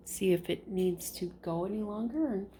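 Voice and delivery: normal talking voice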